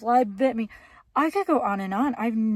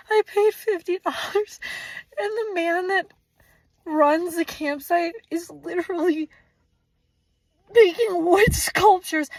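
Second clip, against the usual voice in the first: high, tearful complaint; crying; 5 semitones higher than usual. Real, not acted. Camper listing campsite horrors to viewers, real crying. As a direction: wail the grievances through tears and bits of laughter. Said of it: "I'm crying".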